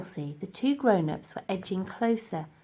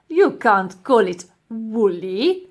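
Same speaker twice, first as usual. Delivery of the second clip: loud, slow disdain; scornful professor; 9 dB louder, slower than usual. Acted. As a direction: dismiss it haughtily. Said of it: "replied the professor".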